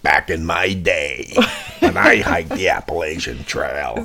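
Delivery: lightly voiced grumpy old man. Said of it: "I wanna talk in my old man voice".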